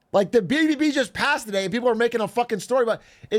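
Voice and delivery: high, worked-up indignation; high-pitched frustrated venting; exasperated rant. Real, not acted. Worked up about bad-faith attacks.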